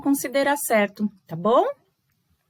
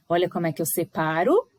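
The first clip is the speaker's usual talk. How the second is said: usual speaking voice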